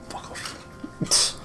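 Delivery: usual speaking voice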